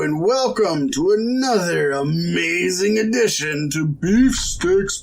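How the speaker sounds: hammed-up show intro, stretched slowly; podcast announcer. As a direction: announce the show with grand flourish.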